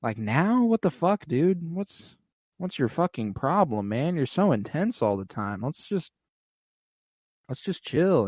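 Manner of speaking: put-on a baffled teen pleading to drop it; Luke exasperated. Luke brushing off Aemond's demand, mock whiny disbelief. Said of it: "And he's like".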